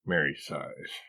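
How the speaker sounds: ordinary delivery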